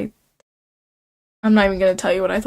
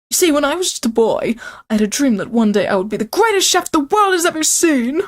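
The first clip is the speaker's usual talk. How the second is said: Colonel Sanders reminiscing; 6 semitones higher than usual. Acted. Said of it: "I don't even know what accent I'm doing for him".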